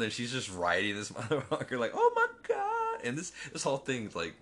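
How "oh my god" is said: high, stretched-out mock wail; shocked cry. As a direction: draw it out high in mock panic. Acting out shock at the ride.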